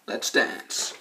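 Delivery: gruff tough-guy line. Retold or imitated. Tough line before machete fight.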